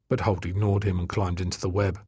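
usual speaking voice

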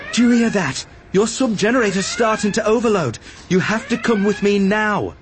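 high, pressing alarm; urgent demand. A narrator's reading. Obi-Wan urging Arad away from overloading machinery, urgent demand. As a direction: insist he come, high-pitched.

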